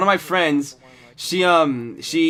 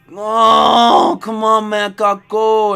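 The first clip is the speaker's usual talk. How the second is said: higher, louder whiny plea; pleading bum; 6 semitones higher, 6 dB louder, slower than usual. Retold or imitated. Bum begs the worker to let him use the restroom, higher plea. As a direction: beg like you can't hold it.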